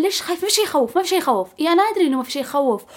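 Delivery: high-pitched mock exasperation; acted back-and-forth. People questioning her fear and her replying, high acted exchange.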